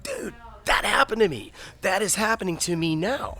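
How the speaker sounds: high, excited near-whisper of disbelief; amazed realization. Retold or imitated. Reading Scripture, stunned it matches his own story, in an excited whisper. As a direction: gasp it out, stunned it's true. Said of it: "I'm like".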